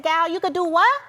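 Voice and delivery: grandmother's Gullah Geechee voice, high and loud; Gullah Geechee dialect. Retold or imitated. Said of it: "that's her Gullah Geechee dialect".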